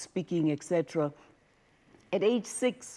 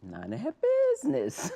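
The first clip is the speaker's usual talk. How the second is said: high, laughing aside; laughing at her own cheeky answer; 9 semitones higher than usual. Real, not acted. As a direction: chuckle it out. Laughing aside after retort.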